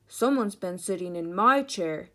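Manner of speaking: loud, great big father-bear voice; Papa Bear. A narrator's reading. Papa Bear sees his chair, great big voice. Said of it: "said in his great big voice".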